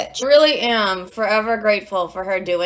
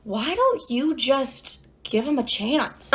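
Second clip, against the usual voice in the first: slow, softer therapist voice with a rising end; imitated therapist; 6 dB quieter, much slower than usual. Therapist suggesting she give him a chance, slow coaxing imitation. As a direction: float a gentle suggestion, drawn out. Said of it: "my therapist goes".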